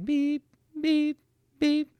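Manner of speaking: very high, even electronic beeps; microwave beep. Retold or imitated. Husband imitating the microwave alarm, high beeping. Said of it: "it beeps again".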